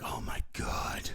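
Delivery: mock the leering commenter, low and breathy; hammed-up low whispering creep; creepy viewer voice. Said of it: "And this guy's like".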